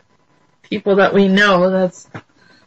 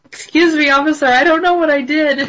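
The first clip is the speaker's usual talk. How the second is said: high-pitched innocent motorist; pleading driver; 7 semitones higher than usual. Acted. Driver to police officer at traffic stop, high pleading.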